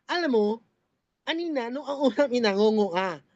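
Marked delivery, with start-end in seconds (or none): none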